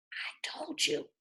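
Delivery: barely voiced, breathy mouthing; just shape the words, almost no sound; mouthed. A narrator's reading. Lulu silently signals Harvey across the table, mouthed. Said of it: "she mouthed".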